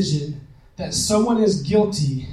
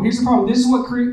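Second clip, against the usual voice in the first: usual speaking voice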